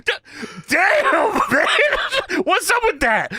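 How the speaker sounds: hammed-up disbelief at a stench; Booker T. Podcaster imitating wrestler reacting to fart sounds, mock disgust. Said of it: "Booker snaps his head back and says".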